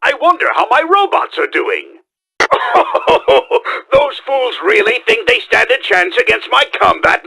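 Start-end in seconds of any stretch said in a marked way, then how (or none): 2.4-3.9 breathy gloating laugh; gloating villain laugh. Acted. Villain laughing as his robots fight. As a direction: cackle at the fools fighting your robots.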